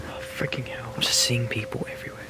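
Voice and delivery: frightened hushed whisper; scared whisper. Real, not acted. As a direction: whisper it, spooked by shadows. Hallucinating alone at night, scared.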